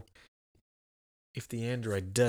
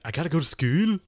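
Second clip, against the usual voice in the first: high, loud Scottish little-girl imitation; Scottish accent; 6 semitones higher, 5 dB louder than usual. Imitating the viral little girl waking up, Scottish accent. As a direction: put on a high Scottish lilt, rising at the end. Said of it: "that's how you do a Scottish accent".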